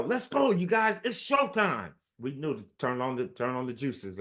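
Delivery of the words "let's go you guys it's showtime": retold yell. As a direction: fire the team up mid-slump. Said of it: "would yell".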